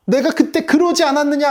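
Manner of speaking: high-pitched acted complaint; mock accusing protest, pitched well up; resentful grumbling. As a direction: throw it out as an aggrieved reproach. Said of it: "원망과 불평을 하는 거예요".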